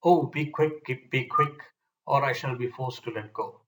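usual speaking voice

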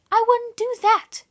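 softly. Acted. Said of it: "said Dora softly".

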